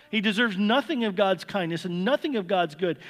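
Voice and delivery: ordinary delivery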